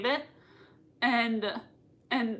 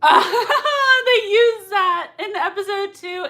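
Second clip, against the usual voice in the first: higher, louder, slow words through laughter; laughing while talking; 8 semitones higher, 7 dB louder, slower than usual. Real, not acted. Fan explaining to viewers through real laughter. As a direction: talk while still laughing.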